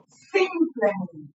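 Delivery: "simplemente" said slowly and clearly; slow pronunciation. Shown as a demonstration. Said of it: "Yo la pronuncio". ("simplemente" pronunciado despacio)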